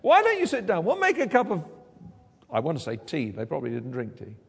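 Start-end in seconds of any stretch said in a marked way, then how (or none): 0.0-1.6 high, fussing mock welcome; chatty hostess. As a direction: gush through the hostess's small talk. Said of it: "She doesn't say".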